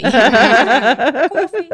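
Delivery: "Coffee" through laughter; laughing mock coffee. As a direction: giggle out "Coffee". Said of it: "can I have a cup of coffee?".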